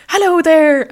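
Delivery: imitating a joyful Irish countryman; cheerful West Cork man. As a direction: sing out a hearty greeting like a jolly local. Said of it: "he was so happy and cheerful and joyful".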